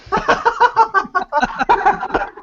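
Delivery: squealing cackle, very high; a shrill burst of laughter; high shrieking laugh. Real, not acted. Mocking the others, a high squealing laugh. Mock argument over who is oppressed.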